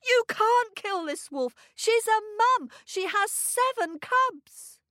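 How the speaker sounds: high, urgent protest; pleading boy. A narrator's reading. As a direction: plead desperately in a boy's high voice. Boy pleads for the wolf's life.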